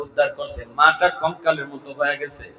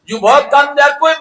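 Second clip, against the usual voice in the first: high, loud sermon cry; full-force preaching; 11 semitones higher, 10 dB louder than usual. Real, not acted. (উচ্চস্বরে জোরালো ওয়াজ)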